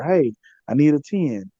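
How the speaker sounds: ordinary talk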